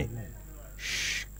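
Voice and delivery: long soft unvoiced shh; shushing. Old factory hushing the child, a long soft shh. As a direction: hush them slowly so they listen.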